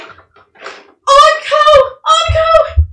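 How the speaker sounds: loud, high mother's call; frantic mom calling. Acted. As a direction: shout her daughter's name with relief. Mother calls for her daughter.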